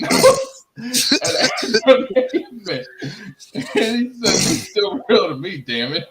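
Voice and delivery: slow, laughing retelling; kind gentle laugh. Real, not acted. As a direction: giggle through the quote. Retelling the meme while laughing.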